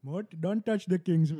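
high, slow scolding lemur king; King Julien. Pretend. Cartoon lemur voice.

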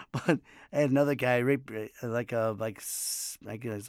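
ordinary delivery

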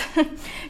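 short breathy giggle; a quick amused chuckle; soft laugh. Real, not acted. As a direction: laugh lightly at yourself. Laughs at her tiny home village.

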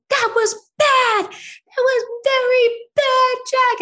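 very high, loud, drawn-out scolding; snapped. Retold or imitated. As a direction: re-enact a mother losing it at her child, word by word.

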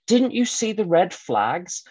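acted therapist's query; questioning therapist. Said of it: "the therapist said".

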